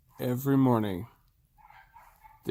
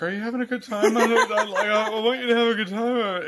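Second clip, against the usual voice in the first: obnoxious; 7 semitones higher, 3 dB louder, faster than usual. Retold or imitated. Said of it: "I was being obnoxious".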